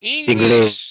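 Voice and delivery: English shouted out loud and low, pitched well below the line before; emphatic shout. Real, not acted. Teacher confirming the class's answer, shouting it out. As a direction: boom the word English at the class.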